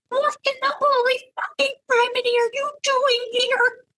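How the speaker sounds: very high, squealed disbelief; shocked outburst. A narrator's reading. Startled woman demanding why he is here, high shocked outburst. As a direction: shriek the question without full volume. Startled by his sudden appearance.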